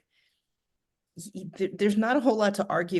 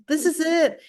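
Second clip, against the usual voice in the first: high, louder retold outburst; mock yell; 9 semitones higher, 5 dB louder than usual. Alone, yelling at the phone while listening, mock yell. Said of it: "I'm yelling at my, at my phone".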